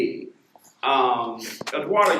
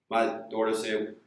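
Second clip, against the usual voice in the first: ordinary talk, nothing marked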